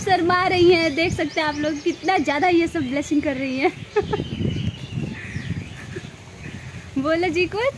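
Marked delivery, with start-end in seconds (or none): 3.8-6.1 soft breath of a laugh; quiet amused chuckle; real laugh. Real, not acted. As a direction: laugh softly at their shyness. Amused at everyone being shy.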